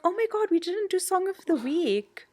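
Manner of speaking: retold high-pitched dismay; mock-panicked outburst at an oversight; shocked realisation. Retells own shocked realisation.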